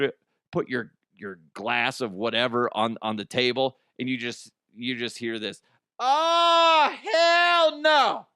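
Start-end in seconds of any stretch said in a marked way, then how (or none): 6.0-8.3 high, loud, indignant diva shout; sassy diva ghost. Pretend. Diva ghost outraged at a missing coaster, high loud shout.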